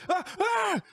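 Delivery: very high yelps of pain; pained cries. Acted. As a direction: yelp as the hand is crushed. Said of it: "He's like".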